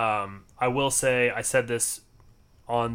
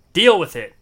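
loud, high burst on a curt dismissal; mock defiant; 6 semitones higher, 12 dB louder than usual. Podcaster to listeners stuck with the short episode, mock defiant. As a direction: throw it out loudly as a mock challenge.

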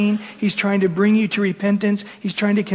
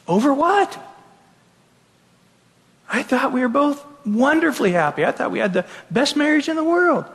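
higher, disbelieving husband's protest; stunned husband; 7 semitones higher than usual. Retold or imitated. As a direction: play the clueless husband caught off guard. Clueless husband hears divorce news.

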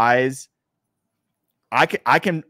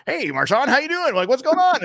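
much higher, chirpy mock-cheery greeting; Pete Carroll impression. Player imitates coach greeting him at five a.m., high and mock-cheery. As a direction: play the relentlessly upbeat coach saying hi at dawn. Marshawn retells Pete's late-night greeting.

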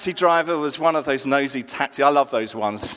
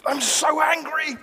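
high-pitched retold indignation; imitated sincere anger; 10 semitones higher than usual. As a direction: imitate an upset listener, voice pitched way up. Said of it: "This, this was sincere".

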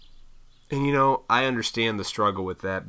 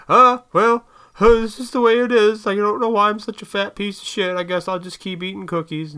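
much higher, louder, whining; mock whiny complainer; 11 semitones higher, 7 dB louder than usual. Mocking people who complain but never change, whiny high voice. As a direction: whine it like a self-pitying slob. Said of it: "They just".

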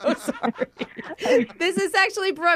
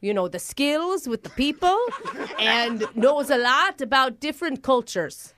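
mock Irish lilt; hammed-up Irish applicant; fake Irish accent; 3 semitones lower than usual. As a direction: put on an Irish accent, explaining hopefully. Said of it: "my grandpappy from Ireland coming through".

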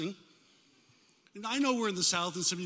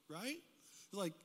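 ordinary talk, nothing marked

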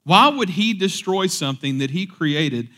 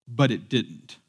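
ordinary delivery

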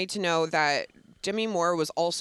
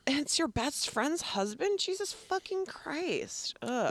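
slow, rising exasperation; real disgust at the bad husband; 7 semitones higher, 8 dB quieter, slower than usual. Real, not acted. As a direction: let the disgust drag out the words. Disgusted reaction to the villain.

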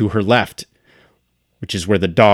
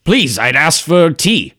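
higher, louder imperious call; Cryolus voice; 8 semitones higher, 4 dB louder than usual. Acted. Cryolus demands tea from Biggle, mildly haughty. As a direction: summon the servant with lordly impatience. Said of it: "and he says".

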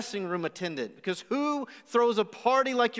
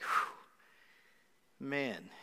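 breathy whew of relief, then a much softer man; relieved sigh; 7 semitones lower, 8 dB quieter than usual. Retold or imitated. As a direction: exhale the relief before speaking. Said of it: "I breathe this sigh of relief".

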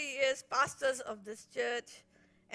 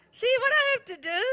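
loud, high playful complaint; laughing exclaim; 11 semitones higher, 13 dB louder than usual. Real, not acted. Wife teasing the congregation about her husband, loud and high. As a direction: tease the crowd loudly.

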